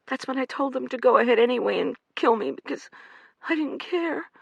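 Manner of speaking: high pleading woman's voice; frightened young woman. Acted. Amanda pleading with the men, frightened and high. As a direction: beg like a trapped animal.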